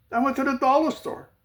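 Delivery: incredulous. Real, not acted. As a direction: pitch it up in comic disbelief. Restated with rising disbelief.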